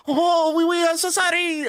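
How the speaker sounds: very high, hammed-up French apology; mock French accent. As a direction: squeal a fake-sorry Frenchman. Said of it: "this random French person goes".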